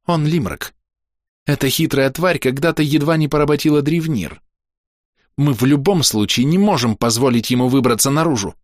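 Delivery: hard, loathing verdict; hateful spitting. A narrator's reading. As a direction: spit the words out with hatred. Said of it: "Она с ненавистью выплёвывала".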